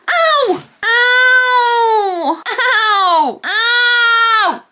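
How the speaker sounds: loud, high-pitched drawn-out "ow"; repeated long wails of pain; pained yelps. Real, not acted. Vlogger reacting to a paper cut, wailing ow.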